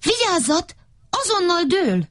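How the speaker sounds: high, urgent warning call; warning shout. Acted. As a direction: yell out the warning as the tree goes. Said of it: "mondták". (magas, sürgető figyelmeztető kiáltás)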